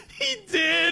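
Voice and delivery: very high squeal through laughter; hysterical laughter. Real, not acted. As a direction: blurt it out while cracking up. Cracking up at the fake name.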